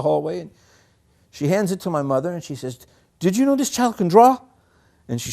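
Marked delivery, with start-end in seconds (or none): 3.2-4.4 high, loud, bursting with excitement; thrilled nurse. Retold or imitated. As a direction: share the amazing news. Nurse thrilled, shows mother.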